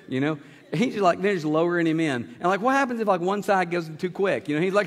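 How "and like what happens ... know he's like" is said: ordinary talk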